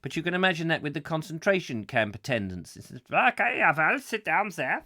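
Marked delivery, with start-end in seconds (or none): 3.1-4.8 higher, put-on German-accented interviewer; mock German accent, slightly louder; comic German accent. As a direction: play a German camp recruiter. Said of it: "I'll have to do the accent".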